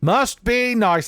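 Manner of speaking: high, slow mock envy; envious dweller, exclaiming. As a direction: draw it out high in exaggerated envy. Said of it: "the Dweller from Vault thirteen's like".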